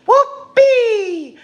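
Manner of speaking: a high whoop of glee; gleeful whoop. Acted. Singing the reindeer cheering Rudolph, high gleeful whoop.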